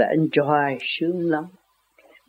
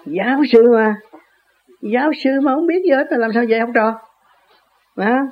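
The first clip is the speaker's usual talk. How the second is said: higher, louder scoff at the professor; scornful mockery; 6 semitones higher, 4 dB louder than usual. Real, not acted. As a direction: sneer at the know-nothing professor. Mocking the professor. (giọng chê bai, cao và to)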